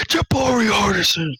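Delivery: high, loud scolding call of the name; stern teacher shout. Retold or imitated. Mister Cali catching Jabari, high stern shout. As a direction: call him out like an angry teacher.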